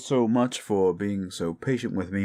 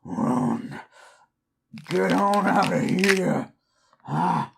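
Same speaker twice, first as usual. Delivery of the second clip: pained snarling growls between words; a man turning into a beast, straining; desperate beast warning someone to run; werewolf transformation; 6 semitones higher, much slower than usual. Acted.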